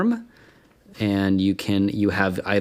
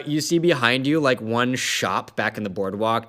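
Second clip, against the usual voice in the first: ordinary talk, nothing marked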